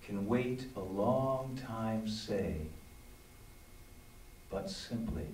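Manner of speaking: slow, deliberate poetry recital; slow, spaced-out poem reading. Acted. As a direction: let each line sit, slowly. Reads his poem aloud.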